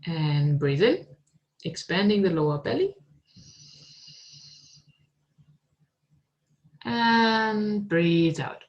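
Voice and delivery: calm, unhurried meditation guide voice; guided meditation. Acted. Teacher guiding students into meditation, calm and unhurried. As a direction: lead them gently inward, with long pauses.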